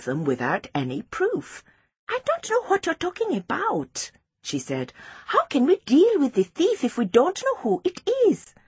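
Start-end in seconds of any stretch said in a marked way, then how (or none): 2.1-4.1 high-pitched feigned innocence; high, innocent little-girl voice; feigned surprise. A narrator's reading. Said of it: "Precious tried to look surprised". 5.3-8.5 put-on high-pitched child's question; high, sceptical little girl; Precious, doubting schoolgirl.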